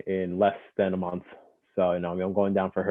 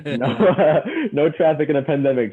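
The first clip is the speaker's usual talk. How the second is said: loud, higher, laughing through the words; 7 semitones higher, 8 dB louder than usual. Real, not acted. Guest jokes to host about empty pandemic roads, laughing. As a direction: talk through a real laugh.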